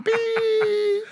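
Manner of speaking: a very high, held beep like ringing ears; imitated ear ringing beep. Recalling firecrackers going off, a high ringing beep. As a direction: mimic the whine of blown eardrums. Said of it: "my ears just going".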